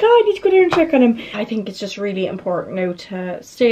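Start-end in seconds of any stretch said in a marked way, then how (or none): 0.0-1.2 high-pitched, quick; high quick inner voice. Retold or imitated. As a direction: squeak it out in a rush. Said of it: "I'm like".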